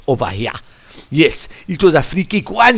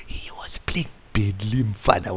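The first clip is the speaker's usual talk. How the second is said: "He was playing badly" in a soft secretive whisper; 6 semitones lower, 10 dB quieter than usual. Real, not acted. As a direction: whisper it as if sharing a secret with the listener. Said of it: "I'll whisper to you".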